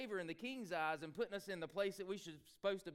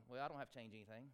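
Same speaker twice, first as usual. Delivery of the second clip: complacent shrug; 6 semitones lower, 5 dB quieter, faster than usual. Retold or imitated. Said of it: "would we all just be going".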